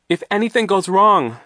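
high, loud warning; urgent insistence. A narrator's reading. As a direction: push to be heard. Duncan insists during the struggle.

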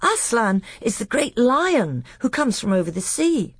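put-on high, eager little boy telling a tale; breathless childish enthusiasm, babbling on; Caspian. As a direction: innocent small-boy storyteller. But he babbled on.

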